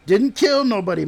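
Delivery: high, loud insistent protest; emphatic defense. Acted. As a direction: jump in to defend him. Defending his ancestor.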